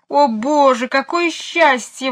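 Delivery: громкий высокий вопль; возопить от облегчения; вопль радости. A narrator's reading. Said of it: "возопила мисс Ларк". (мисс Ларк видит Эдуарда, громкий вопль)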